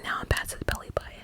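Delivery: usual speaking voice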